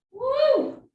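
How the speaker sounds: high loud whoop; excited whoop. Real, not acted. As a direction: cheer it out. Coach cheering end of count.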